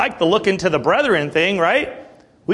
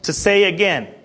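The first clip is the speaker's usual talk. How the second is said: ordinary delivery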